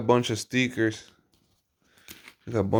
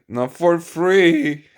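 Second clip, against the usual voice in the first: laughing; 5 dB louder, much slower than usual. Real, not acted. Joking about mailing parts.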